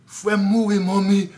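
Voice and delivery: bursting into tears, high and loud; breaking down crying in the car; weeping. Real, not acted. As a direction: cry out for mommy, sobbing. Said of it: "I'm weeping in the car".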